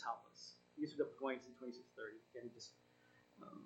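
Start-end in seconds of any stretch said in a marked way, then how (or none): none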